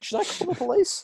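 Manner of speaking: squeaky high joking question; high mock voice. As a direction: pitch it way up, teasing.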